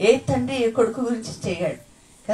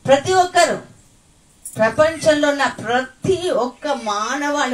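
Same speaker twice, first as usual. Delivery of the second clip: loud, rising proclamation; preaching at full force; 5 semitones higher, 7 dB louder than usual. Real, not acted. Preacher proclaiming every tongue confesses, full force. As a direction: proclaim it to the whole world.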